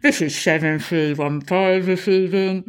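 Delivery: officer answering, lightly muffled; a touch of a muffled reply into a shoulder radio; understated muffled radio answer. A narrator's reading. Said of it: "a muffled voice saying".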